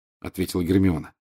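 usual speaking voice

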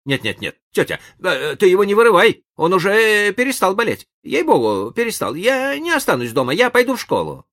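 high, loud hurried pleading; eager protest. A narrator's reading. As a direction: beg her fast, suddenly lively. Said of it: "Том моментально оживился".

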